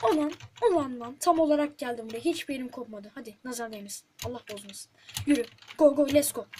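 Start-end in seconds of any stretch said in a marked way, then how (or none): 5.3-6.5 louder, urgent go go; rooting hard for the jump; shouting encouragement at the screen; cheering on. Real, not acted. Cheering the character on.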